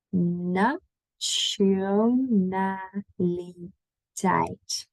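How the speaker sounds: nationaliteit drawn out very slowly, ch sound in the middle; syllable by syllable. Shown as a demonstration. Teacher modelling nationaliteit very slowly. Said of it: "Then last one".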